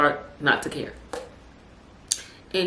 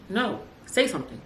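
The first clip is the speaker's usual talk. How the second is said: ordinary delivery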